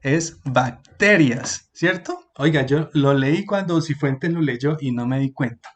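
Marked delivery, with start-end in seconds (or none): 0.4-1.7 "bacterias" very slowly, bac-TE-rias, the C sounded; corrected word with C. Shown as a demonstration. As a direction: split off BAC, then punch TERIAS in "bacterias". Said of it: "le faltó ahí una C". («bacterias» muy lento, marcando la C: bac-TE-rias)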